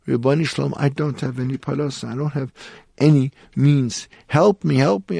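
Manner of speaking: high-pitched imitation of a desperate prayer; mock-crying plea without real tears; retold tearful plea. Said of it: "she started to cry".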